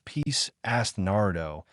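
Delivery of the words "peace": incredulous. Acted. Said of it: "his incredulous tone".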